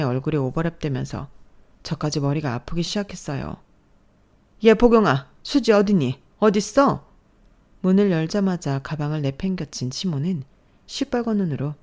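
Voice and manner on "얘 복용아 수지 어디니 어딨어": barging in, loud and demanding; mother-in-law shouting into the house; shouting angrily. Acted. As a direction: yell for the daughter. Furious mother-in-law bursts in.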